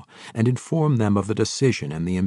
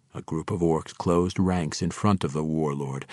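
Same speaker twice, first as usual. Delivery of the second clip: ordinary delivery, nothing marked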